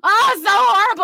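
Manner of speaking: high, loud cringing exclamation; exaggerated groan. Real, not acted. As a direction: cry out in mock horror. Cringing at her own story.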